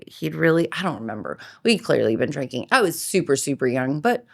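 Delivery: ordinary talk, nothing marked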